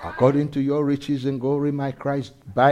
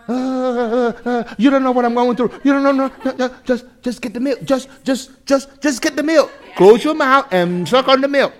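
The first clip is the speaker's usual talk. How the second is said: put-on fussy baby; 10 semitones higher, 4 dB louder than usual. Baby voiced in illustration.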